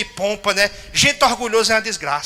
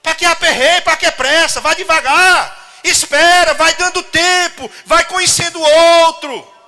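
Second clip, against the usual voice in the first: raised, fervent preaching voice, slowing on vai devagar; 9 semitones higher, 6 dB louder than usual. Acted. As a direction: urge the congregation higher and louder. Preacher exhorts congregation. (pregação fervorosa, mais aguda)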